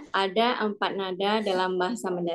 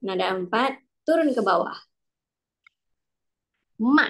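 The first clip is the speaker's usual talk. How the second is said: ordinary delivery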